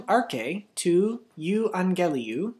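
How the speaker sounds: slow, careful Arche tu euangeliu; unhurried model reading; slow repeat. Shown as a demonstration. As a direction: say it again slowly for students to copy. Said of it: "One more time".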